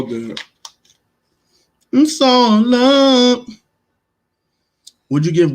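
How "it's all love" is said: slow, high and louder "It's all love"; drawn-out high sing-song. Real, not acted. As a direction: stretch it out high in mock acceptance. Sarcastic resignation after bad pick.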